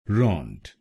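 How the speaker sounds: रॉन्ट said alone as ront; रॉन्ट as a closing ront; syllable rant. Shown as a demonstration. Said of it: "हम बोलेंगे".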